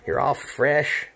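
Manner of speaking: ordinary talk, nothing marked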